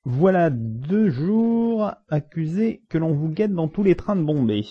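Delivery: high, loud, drawn-out judge's voice; pompous judge. Acted. Judge addressing the accused in court, high and pompous. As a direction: pitch it up and stretch it out like a self-important judge.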